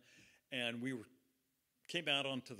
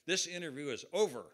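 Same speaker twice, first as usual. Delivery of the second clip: loud, raised declaration ending the interview; indignant outburst; 4 semitones higher, 7 dB louder than usual. Retold or imitated. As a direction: act out storming off, voice up. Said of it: "he jumped up".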